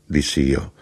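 ordinary delivery, nothing marked

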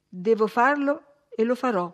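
plain reading voice

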